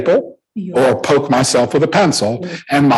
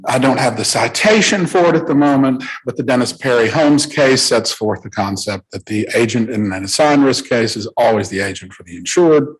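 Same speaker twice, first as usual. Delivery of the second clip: ordinary talk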